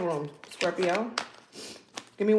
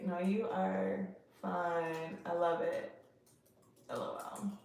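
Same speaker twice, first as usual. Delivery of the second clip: much softer, slow, half to herself; murmured while typing; 3 semitones lower, 14 dB quieter, slower than usual. Real, not acted. Dictating her text back to her date, soft murmur. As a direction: mouth the reply as you type it. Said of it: "I'm gonna say".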